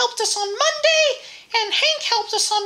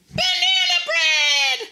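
excited announcement; 6 semitones higher, slower than usual. Pretend. Announcing today's recipe.

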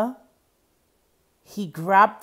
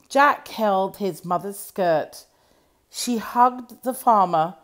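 ordinary delivery

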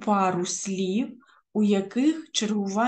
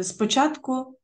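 usual speaking voice

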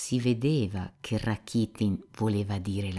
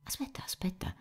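hushed, breath-only plea, very soft; agitated interruption; 10 semitones higher, 9 dB quieter, faster than usual. A narrator's reading. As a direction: cut in with an anxious whisper. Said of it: "lo interruppe Alyosha, agitato". (sussurro agitato)

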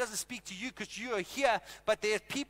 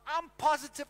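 confident; 5 semitones higher, 7 dB louder than usual. Retold or imitated. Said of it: "I'm like".

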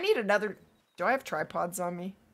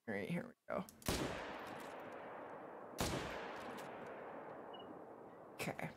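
much softer, dropping to a whisper; hushed; 12 semitones lower, 20 dB quieter, faster than usual. Real, not acted. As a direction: hush right down before the shot. Lining up a shot quietly.

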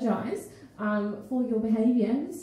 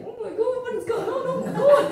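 put-on shocked, much higher fussing little old lady; flustered Italian lady; 10 semitones higher than usual. Italian lady on the street reacting to the leg, shocked and higher. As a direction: fuss like a scandalised street nonna. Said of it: "this freaking little lady Italian".

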